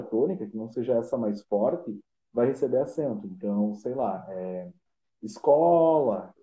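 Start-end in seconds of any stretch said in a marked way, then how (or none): 5.2-6.3 "escola" slowed, CO stretched and louder; stress on CO. Shown as a demonstration. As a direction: call out "escola" with a long, strong CO. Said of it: "eu tenho có como a sílaba tônica". ("escola" com o CO tônico bem alongado)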